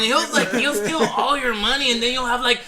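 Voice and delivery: laughing hammed-up Mexican accent; joking Mexican accent. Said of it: "the whole time I did that accent".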